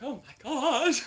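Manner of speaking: loud, high laughing oh my God; giggly embarrassed gasp. Retold or imitated. Guest laughing at her own nerves, high and loud. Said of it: "and I'm like".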